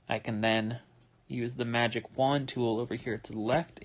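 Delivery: normal talking voice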